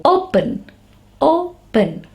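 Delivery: "open" starting high, with O as its letter name; long O sound. Shown as a demonstration. Teacher giving an example of long vowels, long O. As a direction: say "open" twice, separately, long oh. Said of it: "In long sound, the letter is pronounced".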